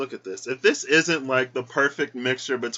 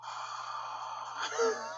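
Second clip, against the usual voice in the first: breathy, choked-up sigh; teary sigh; 12 dB quieter than usual. Real, not acted. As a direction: let out a shaky teary breath. Moved to tears by the letter.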